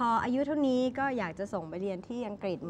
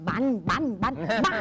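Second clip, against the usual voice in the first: put-on child's vroom vroom car noise, a bit louder; imitated car engine noise; 6 dB louder, faster than usual. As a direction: buzz out บัน บัน like a toy car engine. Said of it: "เขาก็หยิบมาแล้ว". (เลียนเสียงเครื่องยนต์รถแบบเด็กเล่น)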